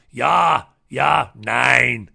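loud mock German ja ja nein. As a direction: bark it like a German official. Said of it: "The Germans rock up".

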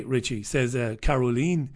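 normal talking voice

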